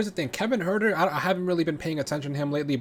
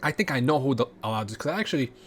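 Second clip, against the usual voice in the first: ordinary talk, nothing marked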